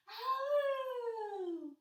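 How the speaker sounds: high, long swooping howl; dog howl. Pretend. Teacher echoing upward dog for kids, playful howl. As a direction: echo back a doggy howl.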